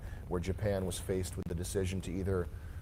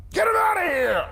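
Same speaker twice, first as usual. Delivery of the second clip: very high, loud mock outraged shout; Hank Hill; 12 dB louder than usual. Host mocking a get-him-out guy, high-pitched shout. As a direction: holler it at full volume, high-pitched. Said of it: "Hank Hill, get him out guy".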